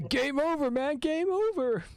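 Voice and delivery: high, hammed-up Game over wail; mock movie panic. Guest echoes the quote in a hammed-up high wail. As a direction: cry it out like a doomed movie marine. Quoting movie line in character.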